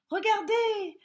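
high, loud call; cried out. A narrator's reading. Rabbit spotting red in the leaves, a high loud cry. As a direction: shout to the others. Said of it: "s'écria un des lapins".

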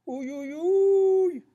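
very high, drawn-out "ouïe" wail; pained yelping. Acted. Reacting to the ogre's mishap, high pained wail. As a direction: squeal it high, stretching the last one.

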